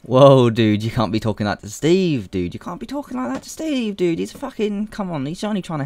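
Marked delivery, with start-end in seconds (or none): 2.5-5.8 squeaky mock scolding; high mock voice. Scolding the video for insulting Steve, high mock voice. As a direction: repeat it much higher in a silly put-on voice.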